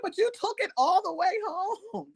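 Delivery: high-pitched, incredulous retold objection; mock-exasperated complaint to a stranger; exasperated protest. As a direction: protest in disbelief, voice pushed way up. Retold protest about taken luggage.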